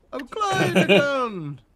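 high, drawn-out clown voice; stretched-out, high-pitched mock clown; imitated clown robot. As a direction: mimic a clown introducing itself, slowly. Mimics the game's clown robot.

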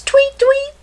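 very high chirpy tweet tweet; high mock birdsong; bird tweet. Imitating bird.

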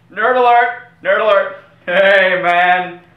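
high, loud alarm yell; nerd alert shout. Acted. A nerd hunter spotting a nerd, loud and high. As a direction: bellow it like a siren going off.

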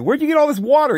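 high, amazed question about the water; amazed visitor. Retold or imitated. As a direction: gasp it out, way up in pitch. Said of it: "And he's like".

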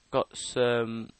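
ordinary talk, nothing marked

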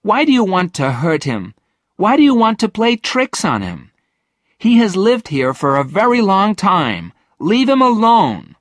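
high, loud, indignant protest; cross, high-pitched defence of the ghost; angry Virginia. A narrator's reading. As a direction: tell them off, pitched high and rising in force. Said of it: "was also angry with the twins".

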